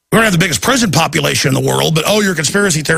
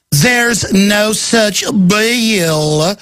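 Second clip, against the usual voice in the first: drawn-out cartoonish mock denial, very slow; cartoon character; 3 semitones higher, much slower than usual. Host mimics the official denying the bill like a cartoon character. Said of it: "like a cartoon character".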